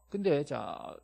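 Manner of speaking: ordinary talk, nothing marked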